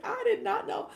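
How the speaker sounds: high, loud mock amazement, imitating a viewer; mock surprised viewer. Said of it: "You're like".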